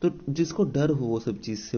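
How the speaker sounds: normal talking voice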